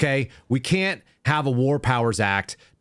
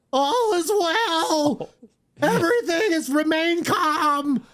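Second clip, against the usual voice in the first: very high, slow, wailing "All is well"; Kevin Bacon panic; much slower than usual. Retold or imitated. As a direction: plead "All is well" like a frantic cadet. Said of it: "That's what this clip is called".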